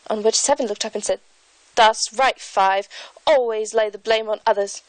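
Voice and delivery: put-on a lightly done English accent, reading aloud; light British accent. YouTuber reads Alice aloud in a light British accent. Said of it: "I'm going to read a part from".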